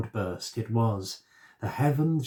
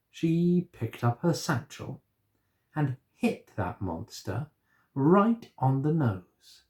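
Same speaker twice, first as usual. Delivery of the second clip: plain reading voice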